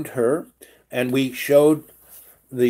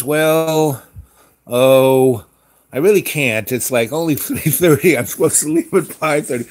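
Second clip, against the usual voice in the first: louder, giggly mock protest; coy refusal; 3 semitones higher, 7 dB louder than usual. Clerk coyly turns down a drink, loud and giggly. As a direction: play the clerk coyly saying she can't. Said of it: "And she goes".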